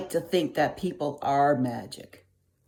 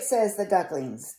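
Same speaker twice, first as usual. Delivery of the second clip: usual speaking voice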